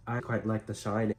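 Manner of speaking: ordinary delivery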